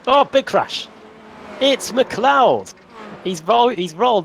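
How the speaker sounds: sudden high excited call. Real, not acted. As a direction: react to a big crash. Sudden crash in live race.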